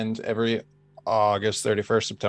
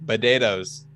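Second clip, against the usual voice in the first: Boston potatoes; slower than usual. Shown as a demonstration. Boston friend demonstrating a local word: potatoes said bedaytoes. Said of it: "Can I have it again?".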